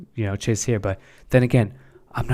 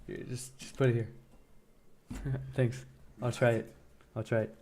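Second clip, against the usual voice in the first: hushed words to someone in the room, away from the stream; quiet off-mic aside; 12 dB quieter than usual. Real, not acted. To a person beside him while streaming, a hushed aside.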